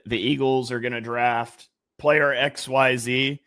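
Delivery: ordinary delivery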